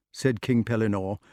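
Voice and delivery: ordinary delivery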